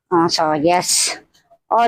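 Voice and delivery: ordinary talk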